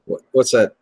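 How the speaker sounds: usual speaking voice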